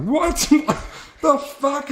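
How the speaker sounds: squeaky, very high disbelief; mock shock. Roommate replaying his shock to his girlfriend, very high. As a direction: shriek it in disbelief.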